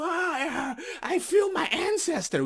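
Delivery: high, theatrical mock anguish; mock wailing. Mocking offended viewers with a high theatrical wail.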